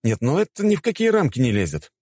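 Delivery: high, exasperated exclamation; indignant outburst. A narrator's reading. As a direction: burst out in disbelief. Narrator's outburst at the farce.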